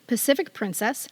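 ordinary talk

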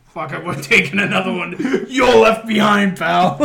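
mock alien. Alien leaving the hero behind, loud and laughing. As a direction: dismiss him loudly.

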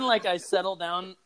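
ordinary talk

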